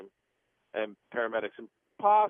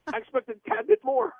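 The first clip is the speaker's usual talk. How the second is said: much higher and louder, hammed-up dry indignation; mock indignant reply; 5 dB louder than usual. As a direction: retort to the rescuers, sarcastic and high. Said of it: "And I was like".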